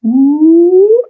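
high, sustained loud "ooh"; imitated ooh. Retelling a healing moment, imitated high ooh. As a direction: let a high ooh glide upward.

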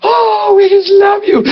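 high, swooning praise; over-sweet worship voice; mock gushing worship. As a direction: ham up the adoring ladies. Mocking worshippers again.

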